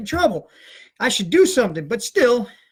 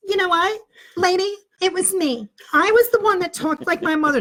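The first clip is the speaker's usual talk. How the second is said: put-on higher own kid voice; 7 semitones higher than usual. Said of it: "Now I'm like".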